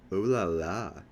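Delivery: drawn-out teasing oh-la-la; mocking mimicry. A narrator's reading. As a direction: tease with a mock-impressed air. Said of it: "Safer mimics".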